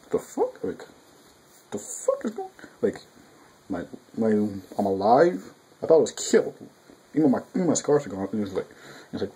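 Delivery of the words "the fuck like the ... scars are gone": stunned Zenkichi. Retold or imitated. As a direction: play the revived guy, shocked he's alive. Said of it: "And he's like".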